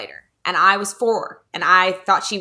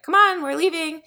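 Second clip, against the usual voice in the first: higher mock call to hurry along; lightly acted calling-out, pitched up; mom calling out; 10 semitones higher than usual. Mom calling her child to leave the store, mock calling out. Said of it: "my mom called me".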